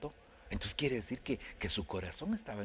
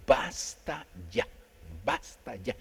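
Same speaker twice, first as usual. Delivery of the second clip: slow, louder stern rebuke; angry God; 4 dB louder, slower than usual. Retold or imitated. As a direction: cut him off slowly, firm and stern. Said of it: "Dios se enojó".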